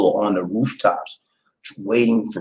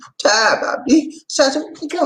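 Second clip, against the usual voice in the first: high, wailing imitation near tears; high mock whimper. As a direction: whine it like he's about to cry. Friend about to cry.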